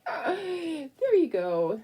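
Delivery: slow, high, soothing coo; soothing the dog. Real, not acted. Settling the dog.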